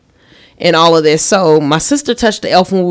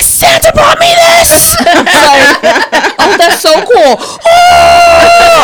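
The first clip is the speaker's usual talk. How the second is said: put-on much higher, louder squealing kid voice; imitating thrilled child; 6 dB louder, much slower than usual. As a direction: shriek with joy like a kid at Christmas. Imitating nephew opening gifts.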